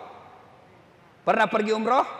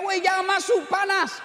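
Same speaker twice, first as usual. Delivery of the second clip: high, loud panicked shout; high-pitched alarm shout; warning yell; 11 semitones higher, 4 dB louder than usual. Retold or imitated. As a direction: yell a frantic warning across the room. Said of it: "Dia mau teriak".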